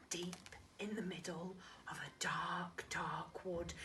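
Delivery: hushed storytelling whisper; breathy spooky-story whisper. Acted. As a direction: whisper it like a scary tale. Kipper reads spooky story.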